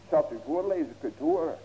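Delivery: ordinary talk, nothing marked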